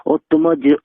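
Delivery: normal talking voice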